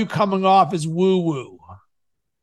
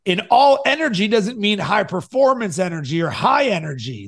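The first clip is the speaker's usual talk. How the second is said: normal talking voice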